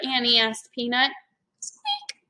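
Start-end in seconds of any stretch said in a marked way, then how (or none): 1.6-2.2 tiny high squeak; mouse squeak. A narrator's reading. Peanut answers Annie, tiny mouse squeak.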